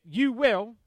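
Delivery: firm, punchy stress on "you will"; emphatic. Shown as a demonstration. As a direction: land "you will" hard as a certainty. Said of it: "in Greek it's very emphatic".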